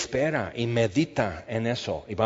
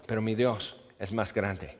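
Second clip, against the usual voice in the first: soft echo; 5 semitones lower, 10 dB quieter than usual. Shown as a demonstration. Preacher echoes his own line, soft and low. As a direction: repeat it low and much softer.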